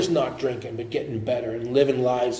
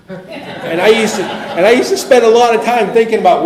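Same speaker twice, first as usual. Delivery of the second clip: laughing; 7 semitones higher, 10 dB louder than usual. Real, not acted. Laughing while recalling despair.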